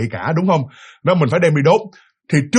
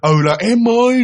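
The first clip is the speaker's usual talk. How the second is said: higher-pitched calling to someone, only slightly louder; mild retold call-out; 6 semitones higher, 3 dB louder, slower than usual. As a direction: imitate calling out for help, restrained. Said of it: "bắt đầu ông mới la lên". (giả giọng gọi với, hơi la lên)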